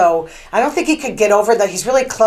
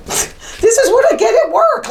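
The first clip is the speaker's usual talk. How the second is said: laughing; 14 semitones higher, 3 dB louder than usual. Real, not acted. Laughing at her own bad luck.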